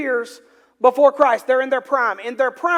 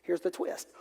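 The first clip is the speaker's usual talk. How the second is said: whispered; 7 semitones lower, 14 dB quieter than usual. Acted. Builds suspense before the reveal.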